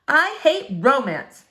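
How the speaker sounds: a cynic announcing her dislike; declared aloud. Acted. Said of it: "said Flora out loud to herself".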